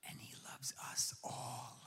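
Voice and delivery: soft sincere whisper. Real, not acted. Pastor telling the congregation of God's love, whispering. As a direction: let it fall to a whisper. Tender climax of sermon.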